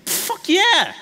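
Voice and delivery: very high, exuberant yell; gleeful admission. Acted. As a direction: own up to it with mock triumph. Gleefully admits the accusation, for laughs.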